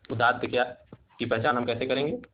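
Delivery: ordinary delivery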